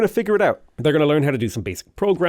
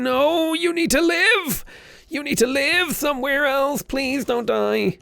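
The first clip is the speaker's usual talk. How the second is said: very high, pleading cry to a dying unit; mock desperate plea; 13 semitones higher, slower than usual. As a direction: beg it not to die, hammed-up. Begging dying unit to survive.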